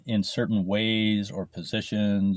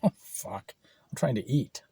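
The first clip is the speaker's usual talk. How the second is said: ordinary talk, nothing marked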